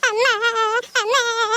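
long, high wailing sobs; child crying for mother. Acted. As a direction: bawl for mother, stretching each cry. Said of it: "ಅಮ್ಮಯ್ಯ, ಅಮ್ಮಯ್ಯ ಅಂತ ಅಳ್ತೈತಿ".

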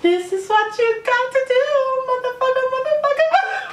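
imitating the singer. To viewers, quoting the guy in the video: high mock voice. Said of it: "guys, he said".

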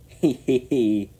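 high, loud drawn-out "hee hee" snicker. A narrator's reading. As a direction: snicker slyly, slow and high. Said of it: "snickered Sir Handel".

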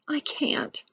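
high voiceless crane croak. A narrator's reading. Crane refusing to adopt the egg, soft croak. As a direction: croak the refusal softly with little voice. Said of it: "croaked the crane".

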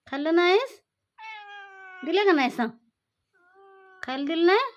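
loud scolding. Real, not acted. Owner scolding her pet cat over food, loud. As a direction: tell the cat off loudly.

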